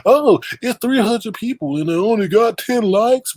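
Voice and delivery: high-pitched mimicked whine; lightly mocking high voice; mocking complaint. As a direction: imitate someone griping about likes. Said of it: "but don't sit up here and say".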